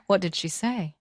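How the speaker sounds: ordinary delivery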